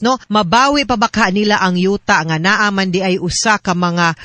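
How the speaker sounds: usual speaking voice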